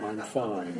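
a pious low "I'm fine" to sound serene; deep spiritual voice. Retold or imitated. AA speaker mocking how newcomers sound spiritual, deep hushed voice. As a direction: drop the voice way down, hushed and holy. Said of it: "so the voice goes down to about here".

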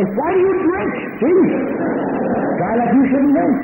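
high, mocking exasperated voice; mock the sympathetic friend; hammed-up high exasperation. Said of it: "You want to say".

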